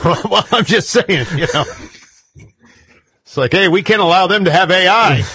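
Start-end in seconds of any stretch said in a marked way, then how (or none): 0.0-1.6 higher voice, laughing through the words; laughing defence. Real, not acted. Guest defends the Iran joke to host, laughing. 3.5-5.2 high, loud mock-outraged quote; mock alarm. Guest parodies objectors to Iran having AI, mock-alarmed.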